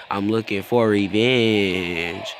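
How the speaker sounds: slow, mock-dramatic vow with a long held ending; drawn-out vow. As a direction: stretch the last word out long. Mock-dramatic vow, echoing [name] line.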